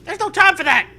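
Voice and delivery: high, loud coach voice; frantic coach. Acted. Coach brushing off the player, high frantic voice. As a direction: squeak it out hurriedly.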